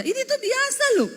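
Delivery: very high, indignant insistence; mock outburst, pitched right up; mock angry protest. Wife insisting to husband her tone is normal, mock angry protest. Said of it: "nadamu tuh nesu loh".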